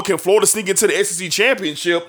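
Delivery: usual speaking voice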